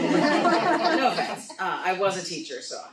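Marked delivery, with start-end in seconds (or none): 0.0-1.5 stretched out through a laugh. Real, not acted. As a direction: laugh while saying no offense, slowly. Repeats apology laughing.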